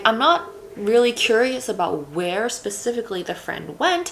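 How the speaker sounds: ordinary talk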